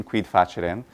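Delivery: "facere" with a soft ch sound, "fachere"; ecclesiastical Latin. Shown as a demonstration. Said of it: "we could use ecclesiastical pronunciation".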